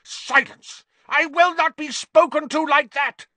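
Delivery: very high, loud enraged shriek. A narrator's reading. Snape silencing Harry, shrieking. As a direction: shriek it out, utterly unhinged. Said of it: "Snape shrieked".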